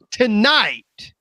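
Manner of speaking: usual speaking voice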